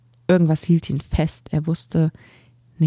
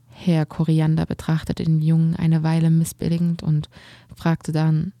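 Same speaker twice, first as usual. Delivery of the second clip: usual speaking voice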